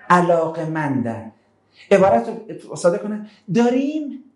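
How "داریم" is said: higher, put-upon voice of ordinary people; raised-pitch mock grumble of the masses; complaining common folk. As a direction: grumble like townsfolk whose life is being disturbed.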